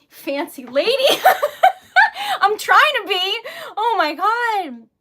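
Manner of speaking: loud, high laugh into near-crying; overwhelmed, laugh and wail together; happy tears spilling into loud words; tearful laughing. Real, not acted.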